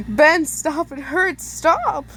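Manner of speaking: begging. Acted. Begging Ben to stop.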